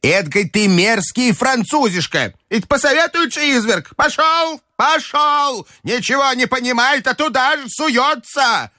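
put-on high, scolding old lady's rant; furious old grandmother. A narrator's reading. Grandmother berating the Frenchman, high furious rant. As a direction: tear into the Frenchman.

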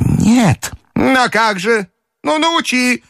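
put-on high, demanding old lady; an imperious old woman insisting; old grandmother voice. A narrator's reading. Grandmother demanding the Frenchman teach her, high and imperious. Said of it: "обратилась к нему бабушка".

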